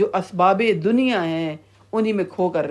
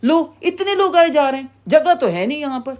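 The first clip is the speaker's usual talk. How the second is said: high-pitched exasperated scolding; angry gatekeeper; 8 semitones higher, 3 dB louder than usual. Acted. Angry keeper of Hell seeing crowds arrive, exasperated anger. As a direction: grumble loudly at the crowd, pitch jumping up. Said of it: "غصے سے وہ یوں دیکھ رہا ہوگا".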